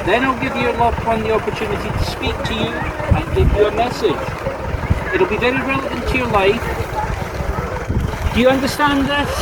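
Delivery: high, soft comic psychic voice; silly medium voice. Shown as a demonstration. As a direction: ham up a spooky stage medium, high and hushed. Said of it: "let me demonstrate".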